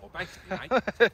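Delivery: imitating a cockney basically, mate, rising high; a cockney mimic, mate hit loud and high; teasing cockney impression; mock cockney. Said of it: "you sound like a cockney".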